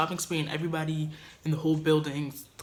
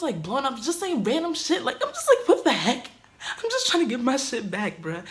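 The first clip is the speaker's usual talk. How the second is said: louder and higher, laughing in disbelief; exasperated laugh; 7 semitones higher, 7 dB louder than usual. Real, not acted. Telling viewers about the roommate's texts, laughing exasperation. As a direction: laugh it out, fed up and incredulous. Exasperated retelling of text fight.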